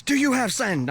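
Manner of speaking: high, sharp interrogating demand; imitated near yell. Guard questions traveler in back room, imitated near yell. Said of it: "almost yells at me".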